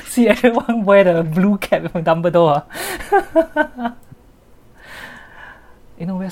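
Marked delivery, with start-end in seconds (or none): 0.1-4.0 laughing. Real, not acted. Joking, laughing.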